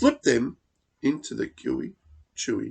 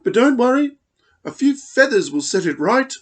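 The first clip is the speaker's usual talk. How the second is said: much higher, louder, airy lady voice; fluttery emu; 11 semitones higher, 7 dB louder, faster than usual. Acted. Emu peers over the brew at Dingo, high fluttery voice. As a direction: flutter it like a vain grand lady.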